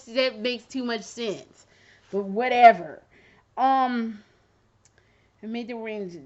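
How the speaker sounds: ordinary talk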